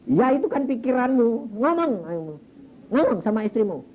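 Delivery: emphatic retold command; acted insistent ordering. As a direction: push him firmly to speak up. Retold pastor orders husband to speak.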